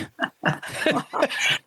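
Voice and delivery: light unvoiced giggle. Real, not acted. Laughs at host's question.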